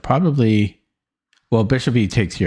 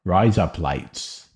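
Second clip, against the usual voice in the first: thick Australian accent; much slower than usual. Shown as a demonstration. Streamer teaching viewers an Australian joke, quick thick Aussie accent. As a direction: Rise up, lights run together fast so it sounds like razor blades. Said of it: "very thick Australian accent".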